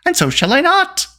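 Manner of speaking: high, indignant refusal; stubborn girl's outburst; defiant cry. A narrator's reading. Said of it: "cried Eilonwy".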